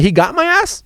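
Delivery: high rising outraged question; can't believe he said that; shocked high "He got my ass?"; indignant disbelief. Retold or imitated. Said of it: "I'm like".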